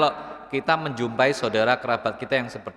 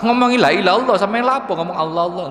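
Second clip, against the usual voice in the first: mock scolding; 5 semitones higher, 3 dB louder, faster than usual. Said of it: "Kemudian digetak".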